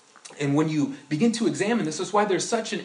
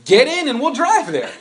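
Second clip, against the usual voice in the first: high, loud mock urging; insistent call; 10 semitones higher, 10 dB louder than usual. Pastor calling the listener into the car, high and loud. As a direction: press them to get in.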